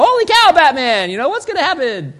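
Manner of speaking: panicking sidekick, high and loud; Robin. Pretend. Sidekick cries out to his hero, mock panicked. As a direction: freak out in a hammed-up high yell. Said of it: "Robin is usually freaking out".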